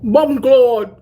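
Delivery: loud Jamaican exclamation; loud mock Jamaican curse; Jamaican accent. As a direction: bark it like a Jamaican. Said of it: "their dialect is fun".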